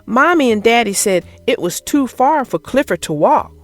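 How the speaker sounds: plain reading voice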